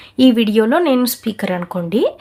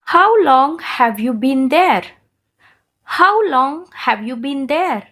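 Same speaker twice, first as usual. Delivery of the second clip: very slow, falling at the end; slow shadowing model; much slower than usual. Shown as a demonstration. Teacher models the question slowly to shadow. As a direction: stretch each word for learners. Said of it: "నేను two times చెప్తాను".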